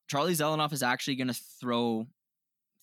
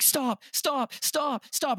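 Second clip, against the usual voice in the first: high-pitched, panicky cries of stop, imitating a cowering fighter; mock panicked yell; 13 semitones higher, much slower than usual. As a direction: squeal stop over and over like a boxer begging to quit. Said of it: "he'll just yell".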